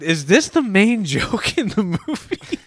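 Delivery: stunned, laughing through the question; mock disbelief. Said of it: "I was like".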